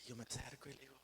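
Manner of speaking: gentle hushed telling. Acted. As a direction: draw them in, hushed. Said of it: "soft storytelling in scene".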